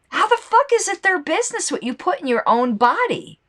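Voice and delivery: high-pitched outraged outburst. Real, not acted. Ranting about drug laws, pitch jumps in outrage. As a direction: voice leaping up in real outrage. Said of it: "I'm getting more and more outraged".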